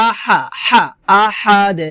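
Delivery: "আহাদ" with pressed h and bounced final d; qalqalah with throat h. Shown as a demonstration. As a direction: press the h in "আহাদ", let the d echo as "de". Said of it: "চাপ দিয়ে উচ্চারণ করতে হবে".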